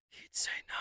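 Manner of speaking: imitating her hushed whisper; mock whisper. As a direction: breathe the words out in a whisper.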